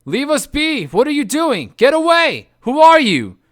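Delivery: high, loud shrieks of protest; shrieking. Acted. Dazed Greeks to their rescuers, shrieking. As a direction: shriek like dragged men. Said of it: "shrieked the lotus-eating Greeks".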